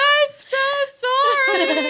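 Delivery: very high, loud imitated wail; hammed-up tearful apology; mock bawling. Little girl embarrassed after farting, mock bawling. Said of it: "she starts bawling her eyes out".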